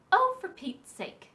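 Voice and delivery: exasperated. Acted. Brownie exasperated at crying fox.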